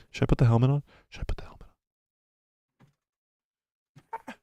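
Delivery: whispered teasing question; playful whisper. Real, not acted. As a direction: whisper it like a secret. Teasing viewers about helmet.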